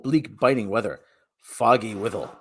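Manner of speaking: ordinary delivery, nothing marked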